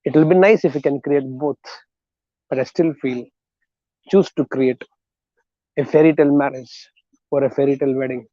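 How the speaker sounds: normal talking voice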